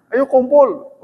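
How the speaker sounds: high, loud summoning call. Retold or imitated. Summoning the companions to assemble, high shout. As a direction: shout to gather everyone fast. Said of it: "begini teriaknya gimana".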